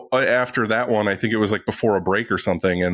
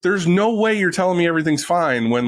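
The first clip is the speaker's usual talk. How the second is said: higher, scoffing disbelief; incredulous inner voice; 8 semitones higher than usual. Retold or imitated. Shop owner voices his scoffing thoughts at the talk, higher. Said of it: "in my mind the whole time, I was like".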